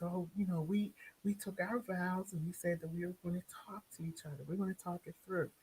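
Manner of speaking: soft, hushed imitation of the wife; quiet, half-whispered appeal; gentle pleading. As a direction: murmur it like a patient, reasoning wife. Retold gentle wife pleading with husband.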